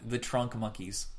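ordinary talk, nothing marked

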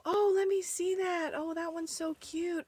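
high, sweet put-on interest; dad feigning interest. Dad to little daughter before throwing her toy, feigned delight. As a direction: coo over a child's toy with false sweetness. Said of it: "he'd go".

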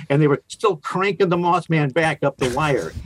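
ordinary delivery

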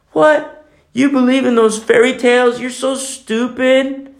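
high, incredulous scoffing friend; a high mocking jeer. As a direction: sneer at the believer's fairy tales.

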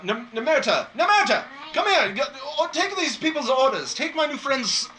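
loud, high shout for the waitress; calling across the room. Acted. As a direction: bellow to the back of the tavern. Host calls waitress across room.

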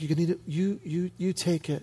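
soft, halting imitation of the wife; hushed, hesitant retold surrender; reluctant concession. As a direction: trail haltingly into giving it up. Wife reluctantly gives up seat.

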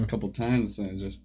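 ordinary talk, nothing marked

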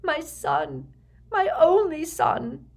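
high, anguished mother's plea; tearful. A narrator's reading. Tearful mother pleading with Severus for her son, anguished. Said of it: "tears sliding down her pale cheeks".

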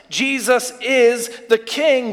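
usual speaking voice

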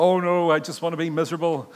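lightly voiced glum religious type, loud; mock pious whine. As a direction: send up the gloomy churchgoer. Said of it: "the religious people will go".

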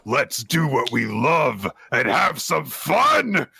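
high, eager battle-joy voice; rally him with fierce delight; gleeful fighter voice. Retold or imitated. Kaiju battle-joy speech.

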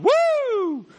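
high, loud whooping woo; retold woo. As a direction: yelp it like a rowdy guy on a train. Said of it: "this dude was on there going".

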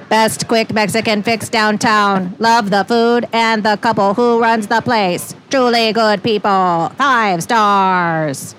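slow, flat robot voice; computer module voice. Acted. [name] reading a diner's review as a slow computer voice. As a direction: read it out slowly like a talking computer. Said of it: "the computer module voice".

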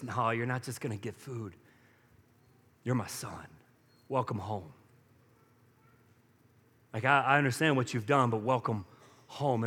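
soft, low, gentle welcome; tender father. Acted.

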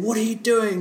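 mock-furious, high-pitched demand; retold anger. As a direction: imitate someone storming up, outraged. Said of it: "She gets so mad".

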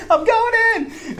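very high, drawn-out squeal; retold giddy excitement. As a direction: squeal it comically to your wife. Retold giddy news to his wife.

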